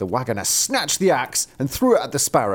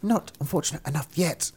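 lightly voiced taunting bird, higher and softer; a hint of a small sparrow; 4 semitones higher, 5 dB quieter than usual. A narrator's reading. Sparrow answering the driver, soft mild taunt. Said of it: "said the sparrow".

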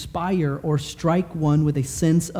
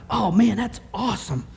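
mock surfer gush; 7 semitones higher than usual. Preacher mimics own casual gush.

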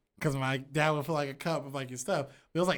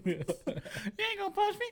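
very high, slow, softer laughing taunt; mocking squeaky taunt; 7 dB quieter, much slower than usual. Mocking friend's fight boast.

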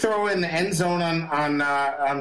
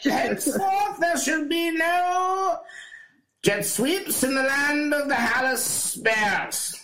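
town crier; 10 semitones higher than usual. Pretend. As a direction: cry it out to the whole kingdom. Mock royal decree, "hear ye.

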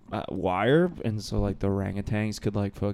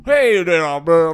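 mock Southern accent; 5 semitones higher, 4 dB louder, much slower than usual. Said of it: "trying to do a Southern accent".

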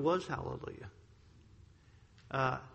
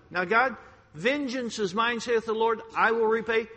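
loud, fervent pulpit delivery; preaching at full force; 6 semitones higher, 5 dB louder than usual. Acted. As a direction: drive it home to the congregation.

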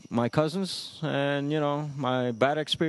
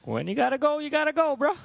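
sing-song catchphrase; 12 semitones higher, faster than usual. Pretend. Repeats catchphrase, higher still.

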